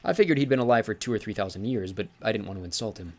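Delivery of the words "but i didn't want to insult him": ordinary delivery, nothing marked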